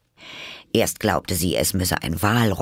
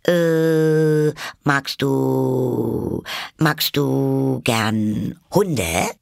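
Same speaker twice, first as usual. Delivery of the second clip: long, high, hesitant drawl; searching for a topic; 7 semitones higher, 4 dB louder, much slower than usual. A narrator's reading. Alice seeks another subject for the mouse, hesitating. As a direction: stall on each phrase until the question arrives. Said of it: "eifrig bemüht, ein anderes Gesprächsthema zu finden".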